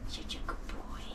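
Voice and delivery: breathy hushed praise; whispering to the dog. Real, not acted. Soothing the dog.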